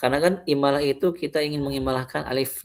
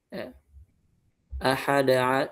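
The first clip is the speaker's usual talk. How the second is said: ordinary talk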